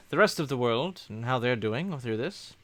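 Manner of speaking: ordinary talk, nothing marked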